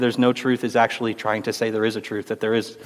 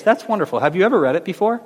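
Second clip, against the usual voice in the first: bright, raised pitch, faster on the question, friendly and unruffled; sweetly disarming question; 6 semitones higher, faster than usual. Retold or imitated. Talking back to a Bible critic, cheerfully disarming. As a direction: turn the critic's arrogance aside with a cheerful, curious ask.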